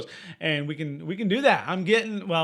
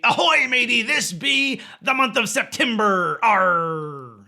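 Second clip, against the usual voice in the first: pirate voice; 5 semitones higher, 6 dB louder, slower than usual. Pretend. Pirate day greeting.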